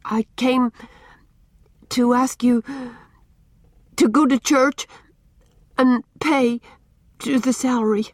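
timid, faltering request; stammered out. A narrator's reading. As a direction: force it out phrase by phrase, nervously. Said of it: "She stammered out her thought".

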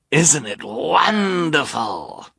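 slow, high sneering villain. Acted. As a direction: drawl it like a gloating rogue. Narrator voices villain Count Olaf.

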